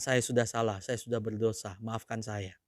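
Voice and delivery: soft, low, contrite apology; humble confession. Shown as a demonstration. Preacher modelling for the congregation, soft and contrite. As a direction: model a sincere apology softly.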